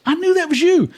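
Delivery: very high-pitched buddy impression; excited recognition. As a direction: exclaim it like a delighted discovery.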